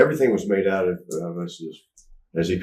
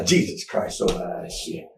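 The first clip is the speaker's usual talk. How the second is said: muttered exasperation; slower than usual. Retold or imitated. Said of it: "imitating promoter's exasperation".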